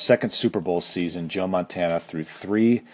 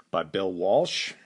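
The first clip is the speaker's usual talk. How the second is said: ordinary talk, nothing marked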